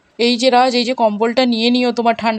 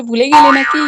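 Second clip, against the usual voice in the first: calling after; 3 semitones higher, 6 dB louder than usual. Acted. Calls after fleeing Raj.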